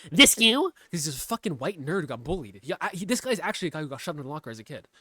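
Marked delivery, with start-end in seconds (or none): 0.1-0.7 loud, high mock challenge; mocking retort. Streamer mimicking the staffer dodging questions, mockingly loud. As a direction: throw it out as a loud, pitched-up jab. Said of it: "And this guy go-, just goes".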